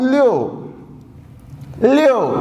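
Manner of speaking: لیو with the stress on the final u, the i kept short; لیو, u vowel prominent; u sound in leo. Shown as a demonstration. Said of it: "یو کی sound آ رہی ہے".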